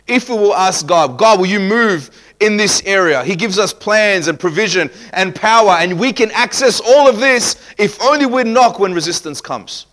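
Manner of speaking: loud preaching, climbing in pitch; full-force preaching. Real, not acted. Preacher urging congregation to ask God, full-force preaching. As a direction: build with rising fervour.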